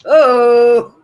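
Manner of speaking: high, very loud, held-out call. Real, not acted. Co-host calling out on stream, loud high uh-oh. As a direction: call out a drawn-out warning.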